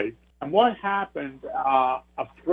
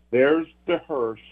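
ordinary talk

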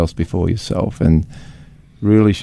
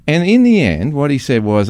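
ordinary talk, nothing marked

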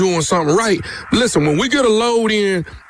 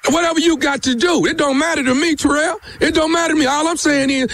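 higher-pitched, insistent argument; confrontational; 7 semitones higher than usual. Acted. Prank caller brushing off Terrell's threat, confrontational. Caller escalates staged quarrel.

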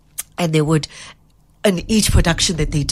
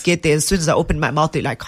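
usual speaking voice

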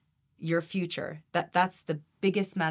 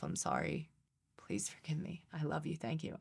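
much softer, almost under the breath; saying it within yourself; 9 dB quieter than usual. Shown as a demonstration. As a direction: murmur the prayer inwardly to the client. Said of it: "I start to say within myself".

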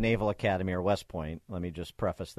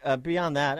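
normal talking voice

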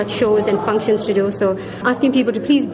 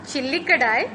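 slow, higher put-on Indian accent; 5 semitones higher, 5 dB quieter than usual. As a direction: shopkeeper in a thick Indian accent. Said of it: "in character using a thick accent".

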